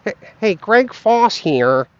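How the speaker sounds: Greg Foss. Acted. Streamer introducing himself as a public figure, impression. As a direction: imitate him, stretching out the name. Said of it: "a pretty good Greg Foss impression".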